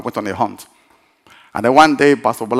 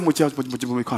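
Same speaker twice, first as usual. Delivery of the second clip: hushed summons; 7 dB quieter, much faster than usual. Retold or imitated. Preacher calling his helper aside before the guest comes, hushed summons. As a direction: beckon someone over quietly.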